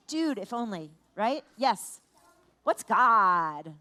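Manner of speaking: usual speaking voice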